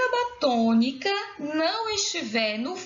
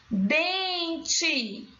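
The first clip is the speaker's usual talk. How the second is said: "Dente" stretched slowly, pitched up; drawn-out syllables; 4 semitones higher than usual. Shown as a demonstration. Teacher showing the stressed syllable, "Dente" drawn out. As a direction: draw out both syllables of "Dente". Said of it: "Qual é a sílaba tônica?".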